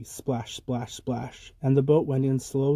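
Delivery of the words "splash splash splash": hushed splash sounds, dropped right down; soft splashes. A narrator's reading. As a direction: let each splash fall softly. Quiet sound words for rowing.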